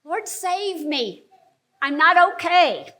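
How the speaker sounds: urgent cry out. Shown as a demonstration. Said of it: "cry out quickly to him".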